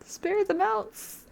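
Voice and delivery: high stretched final defiance; high slow plea. Real, not acted. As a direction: squeak it out slowly, pleading. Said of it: "final insistent choice".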